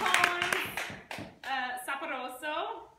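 hammed-up Italian accent, drawn out and loud; mock Italian accent. Editor announcing the book title in a mock Italian accent. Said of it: "I tried to do it in my best Italian accent".

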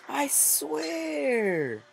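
high, drawn-out disbelieving cry; exasperated outburst. Real, not acted. Gamer raging at the match, high drawn-out exasperated cry. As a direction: stretch it out in high-pitched gamer rage.

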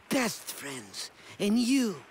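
normal talking voice

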